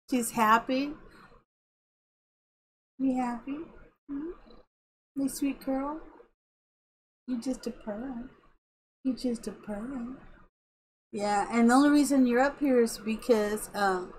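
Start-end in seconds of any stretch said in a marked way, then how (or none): 3.0-10.2 soft pet-talk to a cat; hushed, affectionate murmur; tender cooing. Real, not acted. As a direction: coo very quietly to a purring cat. Softly cooing to her cat.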